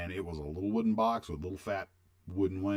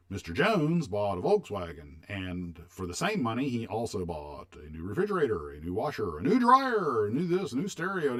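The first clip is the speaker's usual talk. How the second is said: retold TV ad pitch; 4 semitones higher, 6 dB louder than usual. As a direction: sell it like a fifties TV commercial, climbing on each appliance. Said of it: "they had a commercial that said".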